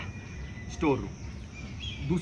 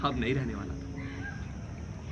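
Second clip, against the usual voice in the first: dramatic hush; 3 semitones lower, 8 dB quieter, much slower than usual. Acted. Dramatic narration low close.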